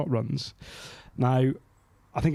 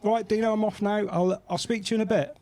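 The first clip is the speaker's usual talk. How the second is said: voicing Scott; 9 semitones higher than usual. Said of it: "Scott's like".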